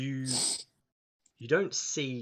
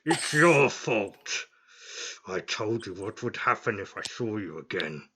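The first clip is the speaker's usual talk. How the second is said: angry minotaur; 7 dB louder than usual. Acted. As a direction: bellow the blame at him.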